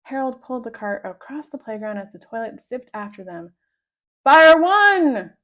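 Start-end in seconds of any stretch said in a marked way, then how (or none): 4.2-5.4 loud, higher firing order; command shout. A narrator's reading. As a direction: bark the order to fire. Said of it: "cried Captain Underpants".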